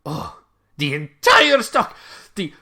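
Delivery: high, loud, delighted merchant; excited shopkeeper. Acted. GM as shopkeeper thrilled by a big order. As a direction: gush over the sale, high and bright. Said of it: "Findilego kinda looks and goes like".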